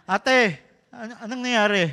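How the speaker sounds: high, loud, eager nosy question; pry like a curious neighbor. Retold or imitated. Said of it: "medyo may pagkamarites ako". (masiglang usisa ng marites)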